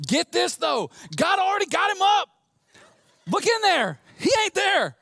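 very high, loud Southern shouting; Southern angel. Acted. Angel announcing the empty tomb, excited Southern holler. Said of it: "It's in the New Southern Translation".

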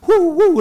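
high, loud "woo, woo"; mock excited whoop. Preacher mimicking thrill-seekers chasing goosebumps, mock whooping.

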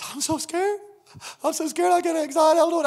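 high, anxious mock passenger voice; imitated scared woman. Voicing a scared plane passenger.

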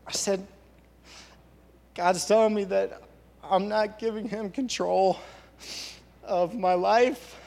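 fighting not to cry as he tells it; real tears close, voice cracking up high; voice breaking. Real, not acted. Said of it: "I'm gonna try not to cry".

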